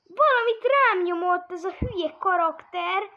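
high, loud frustrated rant; agitated. Real, not acted. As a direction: complain loudly in frustration. Agitated complaint.

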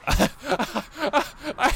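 high-pitched burst of laughter. Real, not acted. Reacts to game's spoken line.

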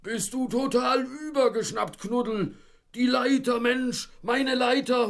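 very high, loud furious yelling. Acted. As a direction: scream threats from up in the tree. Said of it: "fürchterliche Drohungen herabstieß".

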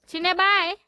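high, loud farewell call; calling out goodbye. Real, not acted. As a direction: call a big goodbye to the pet. (जोरात हाक मारून निरोप देत)

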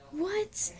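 high, rising shocked question. A narrator's reading. Student finding the crush is the partner, shocked. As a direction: blurt it in rising disbelief.

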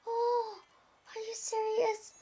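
high, very soft and small; tiny high-pitched voice of disbelief; stunned disbelief. Real, not acted. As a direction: squeak it out under the breath, stunned. Stunned at just missing ten.

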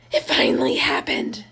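unvoiced hushed whisper; whispered quote. Son whispering his news to mom, hushed. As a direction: breathe it out like a secret. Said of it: "I hear him say".

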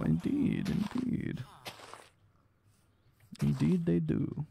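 soft whispered indeed; whispering. Pretend. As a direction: whisper it to yourself. Playful aside after meow.

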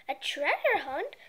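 ordinary delivery, nothing marked

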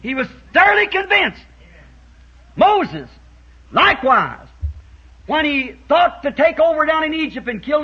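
high, loud preaching rhythm; full-force preaching. Real, not acted. Preacher retelling Moses to congregation, loud and high. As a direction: drive the story home to the church.

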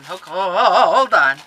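loud, wavering "whoa, hold on"; building to a loud, excited wail; overwhelmed excitement. Real, not acted. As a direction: stammer it out, bursting with excitement. Overwhelmed on spotting the gift.

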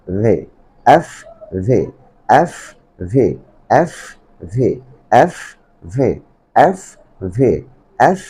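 এফ with air pushed out, ভি voiced with no air; f and v drilled. Shown as a demonstration. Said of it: "আমি সঠিকভাবে প্রনাউন্সটা করার চেষ্টা করছি". (এফ-তে বাতাস বের হবে, ভি-তে হবে না)

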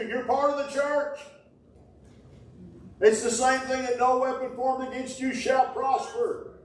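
lifted pitch, pressing the church with conviction; preaching. Real, not acted. Preaching at full force.

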